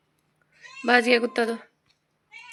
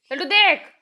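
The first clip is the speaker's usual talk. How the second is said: excited call for attention; 8 semitones higher, 10 dB louder than usual. Real, not acted. Calling baby to look.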